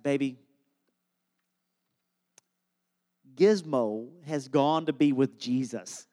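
low, slow, careful dad voice; solemn gentle news. Retold or imitated. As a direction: break it softly, with mock-funeral gravity. Dad solemnly breaks death news.

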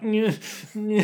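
high little "nyeh" grunts; mock feeble straining. Mocking bad wrist strength, puny high strain noises. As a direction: fake a weak, failing tug. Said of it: "He's got really bad wrist strength".

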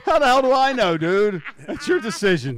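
high, loud playful outburst; teasing shout at the host; mock exasperated shout. Real, not acted. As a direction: yell it back laughing at the boss.

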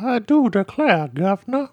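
high, slow Georgia drawl; Georgia Southern drawl. Shown as a demonstration. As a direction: draw it out in a fancy Georgia accent. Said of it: "that's like a Georgia, Georgia Southern drawl".

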